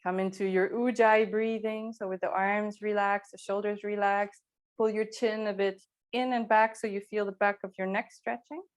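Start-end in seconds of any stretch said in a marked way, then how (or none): none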